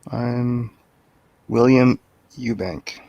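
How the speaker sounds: usual speaking voice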